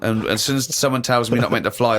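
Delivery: ordinary delivery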